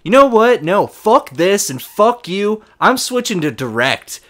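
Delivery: retold caller losing it on the phone; furious customer. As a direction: blow up at the rep. Customer blows up.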